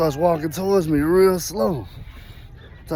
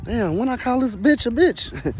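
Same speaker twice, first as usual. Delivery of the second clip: puzzled thinking; 6 semitones higher than usual. Retold or imitated. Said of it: "I'm trying to think".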